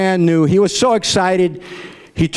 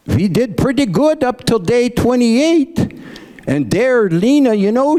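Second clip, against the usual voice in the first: Grandpa's voice; 9 semitones higher than usual. Acted. Grandpa Ole's quoted confession.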